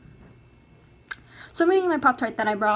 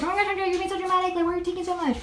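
higher, rushed imitation of complaining friends; mock exasperation; 5 semitones higher, much faster than usual. Everybody teasing her for overpacking, mock exasperation. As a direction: rattle it off in a higher mimicking voice.

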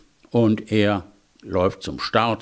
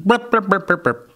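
hopping noises; 8 semitones higher than usual. Acted. Imitating hopping sounds.

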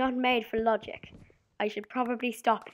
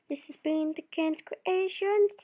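higher, louder and slow, an announcer sign-off; slow, higher announcer outro; channel sign-off; 9 semitones higher, 4 dB louder, slower than usual. Acted. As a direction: announce the channel name grandly.

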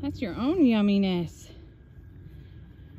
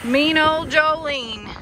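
mock scolding; 3 semitones higher, 8 dB louder, much slower than usual. Real, not acted. Scolding goat in mock pity.

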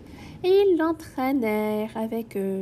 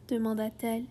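ordinary delivery, nothing marked

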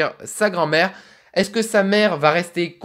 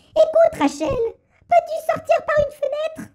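put-on much higher, thin old woman's voice; little old lady voice. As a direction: squeak it out like a tiny grandma. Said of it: "on va faire une petite voix de vieille".

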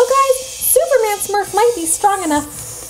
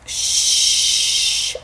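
whispered shush; 3 dB quieter than usual. Real, not acted. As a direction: gasp then whispered shh. Hushing kids about plan.

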